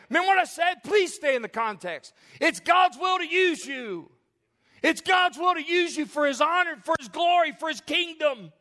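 high, forceful pulpit proclamation; ringing preacher's exhortation; impassioned preaching. Real, not acted. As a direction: drive each phrase home to the congregation.

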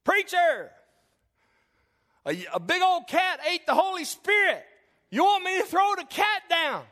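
put-on high, loud kid's voice from the rafters; a freckled boy shouting down from above; little boy calling down. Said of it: "he hears".

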